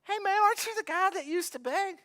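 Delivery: high, curious neighbor questioning. Retold or imitated. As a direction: quiz him like a suspicious neighbor. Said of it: "they go".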